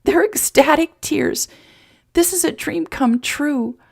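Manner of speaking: very high, overcome with joy; ecstatic through tears. A narrator's reading. Emma accepting life on Jason's ranch, tearfully ecstatic. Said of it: "Emma hiccuped and cried and laughed".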